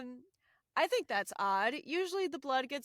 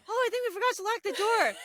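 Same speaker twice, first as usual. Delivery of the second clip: quoted worry; 9 semitones higher, 6 dB louder, faster than usual. Retold or imitated. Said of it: "she's like".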